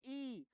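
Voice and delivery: ई sounded alone as a held "ee"; isolated, sustained ई; demonstrated vowel. Shown as a demonstration. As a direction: say ई as a drawn-out "ee" for learners to copy. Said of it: "आप उच्चारण करके देखिए".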